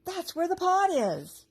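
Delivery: high, loud and slow; thrilled discovery; excited. A narrator's reading. Rachel excited by goal.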